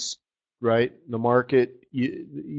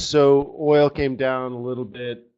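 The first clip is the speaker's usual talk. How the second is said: ordinary delivery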